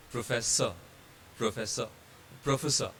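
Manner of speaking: "professor" ending in an open vowel, no R; silent final R. Shown as a demonstration. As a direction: drop the R at the end of each "professor". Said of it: "R becomes silent".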